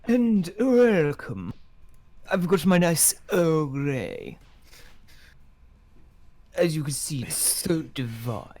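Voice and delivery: put-on enchanted villain now a British gentleman with tea, in a British accent; posh British accent. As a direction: savour every word like a proper English gentleman, in a British accent. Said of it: "he will turn British again".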